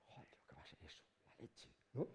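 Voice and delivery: hushed, breathless whisper; awed whisper. Acted. Speaker marvelling to the audience in an awed whisper. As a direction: whisper it in amazement. Awed aside after the scene.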